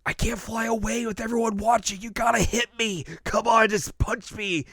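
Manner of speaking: high, pleading hero voice; Goku pleading. Retold or imitated. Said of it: "He's like".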